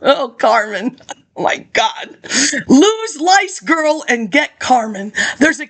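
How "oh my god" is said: ordinary talk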